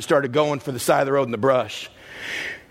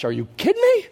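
incredulous squeak. Acted.

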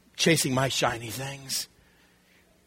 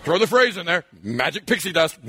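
ordinary talk, nothing marked

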